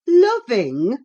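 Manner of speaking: loud scornful rising "Loving?". A narrator's reading. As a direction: spit the word with scorn. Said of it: "as scornfully as I could utter the word".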